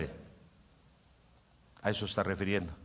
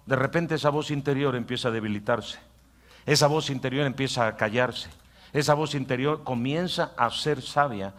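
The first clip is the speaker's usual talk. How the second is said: normal talking voice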